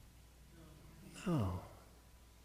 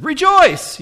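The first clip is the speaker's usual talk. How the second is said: much higher, louder chirpy rejoice; mock cheery command; 14 semitones higher, 10 dB louder than usual. Preacher imitates a pushy cheerful stranger, high and loud. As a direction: chirp it at someone having a bad day. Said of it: "walked up to you and said".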